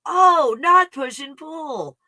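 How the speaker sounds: high, loud oh, then a slow dawning realisation; imitating an amazed student. Host mimics students realising on day twenty-three, slow and amazed. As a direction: play the penny dropping, slowly. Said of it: "they're like".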